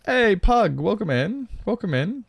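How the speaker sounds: high, loud cheery welcome; excited greeting. Real, not acted. Streamer loudly welcomes Pug into the stream, high and cheery. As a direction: greet them like a hype host.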